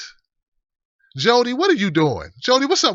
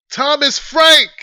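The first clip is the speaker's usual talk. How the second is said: loud, high, drawn-out exclamation; triumphant recall; 9 semitones higher, 6 dB louder, much slower than usual. Real, not acted. Host suddenly recalls tomorrow's guest, triumphant shout. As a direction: blurt out the name you finally remembered.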